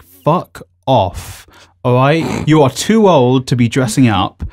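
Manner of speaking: normal talking voice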